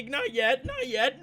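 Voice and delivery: ordinary delivery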